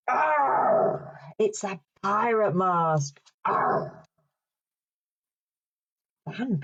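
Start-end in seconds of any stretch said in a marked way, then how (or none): 0.1-1.2 high, drawn-out pirate arr; pirate growl. Pretend. Presenter doing the pirate noise with children, playful growl. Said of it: "Can you do that after three?". 3.4-4.0 rasped pirate arr; pirate growl. Pretend. Presenter showing off the pirate mask, playful growl. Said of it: "what noise a pirate makes".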